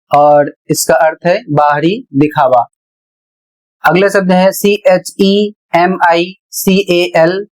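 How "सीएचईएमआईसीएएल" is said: slow, letter by letter; spelling out. Shown as a demonstration. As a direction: spell it slowly in small groups. Teacher spells out a word. (धीरे-धीरे अक्षर बोलकर)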